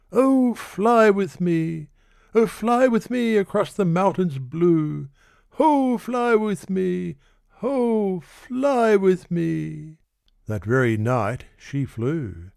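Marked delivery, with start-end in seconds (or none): 0.1-1.9 high, slow drawn-out plea; a slow, high, romantic call; lord imploring. Acted. Lord's refrain. 2.3-5.1 high, sweeping romantic plea; sing-song entreaty over the mountains; a lilting, high invitation; lord imploring. Acted. Lord's refrain. 5.5-10.0 high, very slow, theatrical call; slow, distant-sounding, drawn-out "Ho, fly with me"; hammed-up lord. Refrain grows theatrical.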